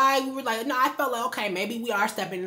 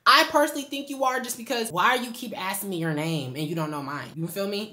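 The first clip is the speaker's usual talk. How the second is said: normal talking voice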